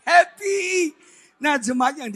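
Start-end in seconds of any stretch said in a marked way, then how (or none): 0.1-0.9 loud, high "happy"; hammed-up carefree cheer, pitched high; happy-go-lucky sanguine. Preacher acts a sanguine man, high loud cheer. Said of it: "kalau cowok sangin".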